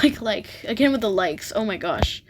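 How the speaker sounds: usual speaking voice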